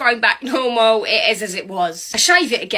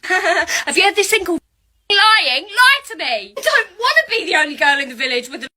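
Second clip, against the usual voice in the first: mock outrage; 7 semitones higher than usual. Teller scolds the doctor, laughing mock outrage. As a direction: plead half laughing, half yelling.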